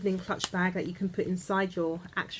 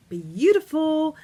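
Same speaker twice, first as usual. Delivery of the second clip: slow, drawn-out and higher, much louder; swooning over the perfume; gushing; 8 semitones higher, 10 dB louder, slower than usual. Real, not acted. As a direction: stretch the word out in delight. Gushing over the gift set.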